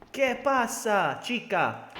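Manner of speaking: loud, drawn-out Spanish accent. Shown as a demonstration. Streamer showing chat his accent, loud Spanish flourish. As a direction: show off your Spanish accent, high and slow. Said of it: "You see the accent".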